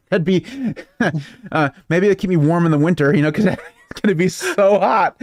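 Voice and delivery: real chuckle while talking; amused joking. Real, not acted. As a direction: let the laugh break into the joke. Joking, laughs through the line.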